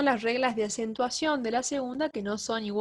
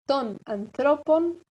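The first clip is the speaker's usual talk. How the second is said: slow Ton anthropon, stressed on THRO; accent moved along; 3 semitones higher, slower than usual. Shown as a demonstration. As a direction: say anthropon as an-THRO-pon, deliberately. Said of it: "Va a correr el acento una sílaba".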